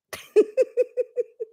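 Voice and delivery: high helpless giggle; relapsing giggles. Real, not acted. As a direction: let the giggles escape again, high-pitched. Laughter returns.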